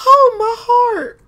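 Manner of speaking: high, loud and slow mock heart attack; dismayed. Real, not acted. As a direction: gasp it like you're about to faint. Prisoners too close to door.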